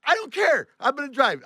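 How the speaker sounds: high, loud outburst; defiant. Retold or imitated. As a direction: shout down the boss's objection. Retold quarrel with boss.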